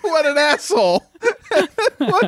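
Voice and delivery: high squeal of laughing disbelief; laughing outrage. Real, not acted. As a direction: laugh it out in shocked delight.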